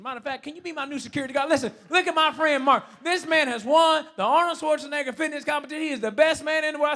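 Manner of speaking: high, shouted proud announcement; loud hype man. Retold or imitated. Celebrating his new friend's win to the terminal, excited shouting. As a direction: proclaim it to the whole terminal.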